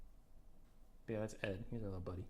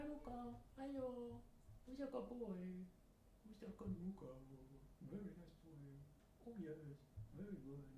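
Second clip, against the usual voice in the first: high, soft cooing voice; 7 semitones higher, 10 dB quieter than usual. Real, not acted. Owner fussing over his pet Mukau, high soft baby talk. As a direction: coo sweetly at the pet.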